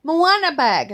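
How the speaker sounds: put-on high, slow little-girl voice; granddaughter voice. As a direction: play a small child announcing her choice. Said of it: "She goes".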